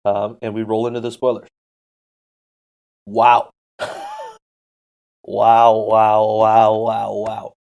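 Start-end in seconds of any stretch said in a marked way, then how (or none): 3.1-7.5 repeated awed wows; stunned, say wow over and over; amazed. Real, not acted. Stunned reaction to spoilers.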